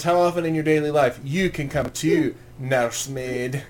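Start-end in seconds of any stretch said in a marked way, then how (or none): 1.2-3.6 hammed-up Scottish movie quote, slowing on the last word; shitty Scottish accent. Movie fan reusing his favorite line in daily life, bad Scottish accent. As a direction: do a bad Scottish warrior, drawing out nursemaid. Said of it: "your shitty Scottish accent".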